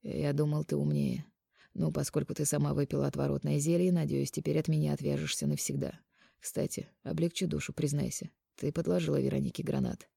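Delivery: soft, subdued disappointment; sadly summing up. A narrator's reading. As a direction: confront her quietly and wearily. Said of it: "печально подвёл итог Марк". (тихо, печально и устало)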